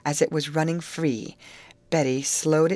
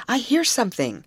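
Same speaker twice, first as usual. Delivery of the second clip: excited alert; 8 semitones higher, 4 dB louder than usual. Acted. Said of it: "cried Molly".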